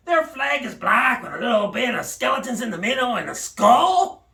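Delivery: ordinary delivery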